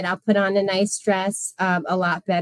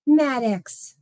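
gasp, then a higher stunned name; gasping surprise; 5 semitones higher than usual. Real, not acted. Streamer spotting a big gift from Maddox, gasping in surprise. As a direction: caught breathless by the gift. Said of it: "My heart skipped a beat".

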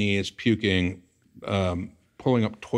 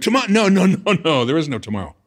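high, loud desperate no no no; panicked protest; 8 semitones higher, 8 dB louder, faster than usual. Retold or imitated. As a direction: beg against the delay, voice cracking upward. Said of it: "I'm like".

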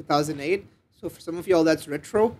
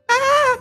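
sudden high-pitched shriek; high scream; 6 dB louder than usual. Acted. Rachel caught by a creature, a high frightened scream. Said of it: "Rachel screamed".

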